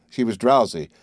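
usual speaking voice

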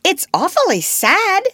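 put-on high, loud, gleeful child; cheerful. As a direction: call it sad with obvious relish. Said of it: "the first twin said cheerfully".

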